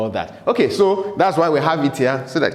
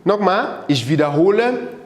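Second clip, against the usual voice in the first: ordinary talk, nothing marked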